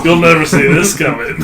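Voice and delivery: loud, high villain taunt; anime villain. Pretend.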